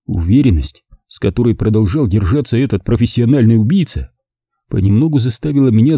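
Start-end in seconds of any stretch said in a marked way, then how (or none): none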